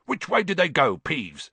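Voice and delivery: lightly voiced impatient caretaker; urgent interrogation. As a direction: demand an answer, high on the opening. Caretaker urgently questions Peeves.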